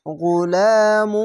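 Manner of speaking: গুলামু chanted very slowly with the গুন্না held; slow recitation. Shown as a demonstration. Teacher modelling গুলামু, drawn out. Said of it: "এখানে ঈদগামে বা গুন্না".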